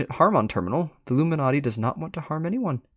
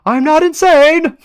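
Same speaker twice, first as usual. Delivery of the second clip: much higher, louder and slow; slow shout; 11 dB louder, slower than usual. Acted. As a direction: scream every word back. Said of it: "Term screams again".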